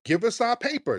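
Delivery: high, quick mock offer; playful high bargaining. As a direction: sell it like a cheeky deal. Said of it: "we said".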